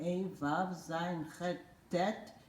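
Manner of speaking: ordinary talk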